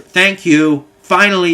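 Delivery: exasperated relief. Retold or imitated. Old man finally gets answer.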